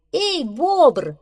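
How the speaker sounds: high, loud squirrel hail; calling out. Acted. Squirrel to beaver on the riverbank, calling. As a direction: call across to get his attention. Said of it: "позвала белка".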